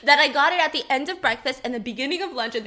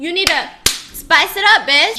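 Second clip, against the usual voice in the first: loud teasing; 4 semitones higher, 10 dB louder than usual. Pretend. Teasing her single cousin.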